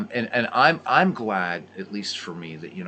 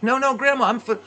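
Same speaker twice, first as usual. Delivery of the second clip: put-on much higher, louder boyish protest; pleading grandson; 6 dB louder, faster than usual. Grandson refusing another plate from his grandmother, high pleading. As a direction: beg off more food without offending.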